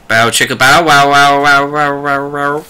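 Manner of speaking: slow wah-wah echo noise; reverberating armor imitation. Game master voicing Rin's echoing armor, reverberating. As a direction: drone it out like a suggestive funk riff. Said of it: "you hear Rin in his armor reverberating".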